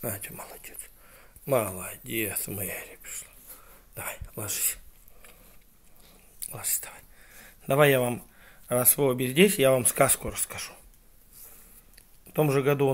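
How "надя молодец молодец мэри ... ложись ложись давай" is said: soft, low settling-down voice; hushed bedtime voice. Real, not acted. Parent settles the children down to sleep, hushed.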